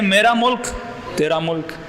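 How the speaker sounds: ordinary delivery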